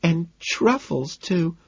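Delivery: high, drawn-out delighted squeal. A narrator's reading. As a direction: squeal it, stretching the words. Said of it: "sticks her head out and squeals".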